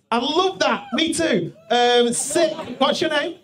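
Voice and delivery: normal talking voice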